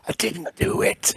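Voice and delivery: drawn-out whispered regret; mock-anguished whisper. Real, not acted. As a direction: whisper it like a comic lament. Repeats the regret for comic effect.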